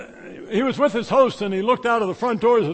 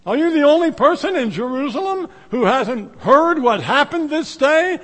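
incredulous question; 6 semitones higher, 6 dB louder than usual. Acted. Preacher voices Cleopas to stranger.

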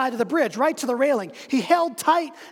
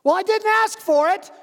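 high, loud indignant shout; angry retort; 9 semitones higher, 5 dB louder than usual. Acted. Man on bridge shouts back indignantly. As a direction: snap it back in protest.

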